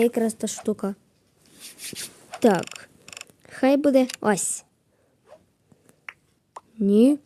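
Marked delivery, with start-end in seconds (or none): none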